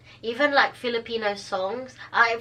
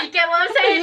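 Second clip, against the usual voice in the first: mock wailing; 8 semitones higher, 5 dB louder, faster than usual. Mock crying plea.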